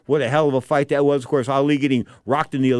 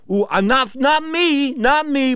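mock innocent denial; 11 semitones higher, 3 dB louder, slower than usual.

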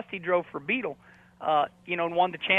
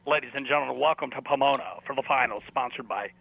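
deep announcer voice; 5 semitones lower than usual. Shown as a demonstration. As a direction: boom it out like a track announcer. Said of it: "Can you do it?".

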